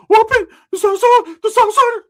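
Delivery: very high, loud panicky squeal; mock nervous. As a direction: squeal it like a jittery guy fresh out. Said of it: "He's all fucking nervous".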